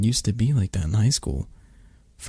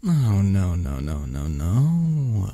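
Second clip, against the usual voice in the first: playful refusal; much slower than usual. Acted. Refusing a partner's bait into cuddles, playful refusal. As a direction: refuse with mock sternness, stretching the last no.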